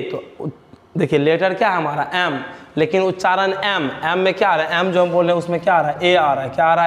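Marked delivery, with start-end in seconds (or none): none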